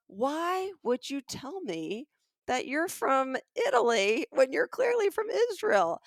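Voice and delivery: high, drawn-out disbelieving question; incredulous questioning. Retold or imitated. Shopper confronting the seller about Italy, high incredulous questioning. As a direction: press him in baffled disbelief. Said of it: "And I go".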